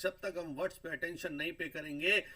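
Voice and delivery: ordinary talk, nothing marked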